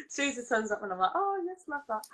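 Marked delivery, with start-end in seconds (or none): 1.1-2.0 high, soft approving quote; pitched-up, lightly voiced approval; pleased reaction. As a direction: quote your own delight, high and quiet. Quoting own pleased reaction.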